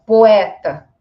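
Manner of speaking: "poeta" as po-EH-ta, punched loud; E in hiatus. Shown as a demonstration. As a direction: hit the E of "poeta" as its own syllable. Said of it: "duas vogais juntas".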